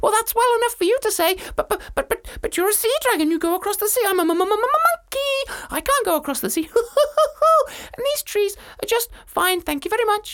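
high, loud laughing monkey. Acted. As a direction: chatter it out like a cheeky monkey. Monkey voiced in story.

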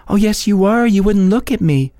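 put-on high, teasing girl's voice. A narrator's reading. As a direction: playfully insist. Girl insists teasingly.

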